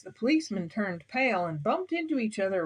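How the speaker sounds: plain reading voice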